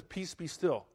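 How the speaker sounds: Peace, be still, said softly and gently; soft, gentle, wrong way; soft gentle version. Retold or imitated. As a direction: say it meekly, like a lullaby. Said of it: "He didn't say it like that".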